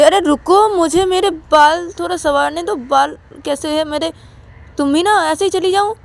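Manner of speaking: high-pitched call to wait; acted fussy protest, pitched high. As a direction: object with a much lifted voice. Stops friend to fix hair.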